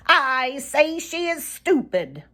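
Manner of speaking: high teasing bird voice; Sammy Jay mocking. A narrator's reading. Sammy Jay insults Granny Fox to Reddy, high and teasing. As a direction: needle him in a high voice.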